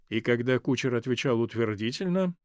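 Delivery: ordinary delivery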